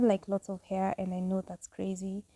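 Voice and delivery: ordinary talk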